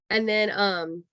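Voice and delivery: usual speaking voice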